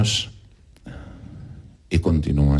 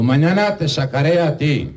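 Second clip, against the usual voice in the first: high-pitched promise; 11 semitones higher than usual. Acted. Man promises pig.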